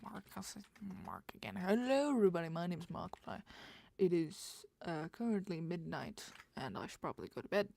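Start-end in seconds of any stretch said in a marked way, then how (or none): 1.6-7.7 put-on light British accent intro. A mild British accent doing a Markiplier-style intro. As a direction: greet like a lightly British host. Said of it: "I turned it into British".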